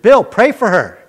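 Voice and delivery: calling on Bill to pray, loud and high; retold urgent call. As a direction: pass the job off with a nervous shout. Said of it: "I said".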